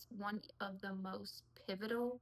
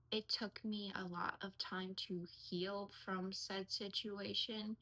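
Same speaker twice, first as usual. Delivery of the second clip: ordinary talk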